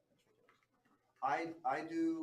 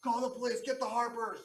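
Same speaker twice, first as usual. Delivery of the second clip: loud, high, frantic calls for help; panicked shouting diplomat; 7 semitones higher, 5 dB louder than usual. Acted. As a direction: yell for the guards like an outraged official. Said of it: "He's like".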